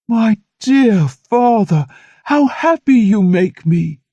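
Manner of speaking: slow elated outburst; overjoyed. A narrator's reading. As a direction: savor each word in gratitude. Armand overjoyed at father's blessing.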